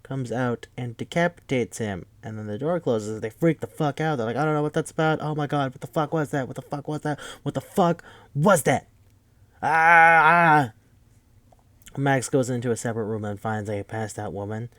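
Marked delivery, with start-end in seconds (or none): 4.4-8.9 higher, frantic mock panic; panicked. Characters after seeing a man decapitated, higher mock panic. 9.6-10.7 high, loud short mock screams. Characters freaking out after the decapitation, loud mock screams.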